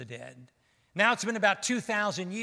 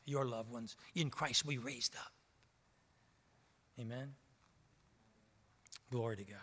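much softer and lower, close and intimate; hushed preaching; 6 semitones lower, 10 dB quieter than usual. Acted. Preacher quietly assuring his church, softer and lower. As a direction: bring the church in near, almost confiding. Preacher speaks softly of departed.

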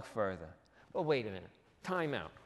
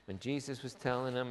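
normal talking voice

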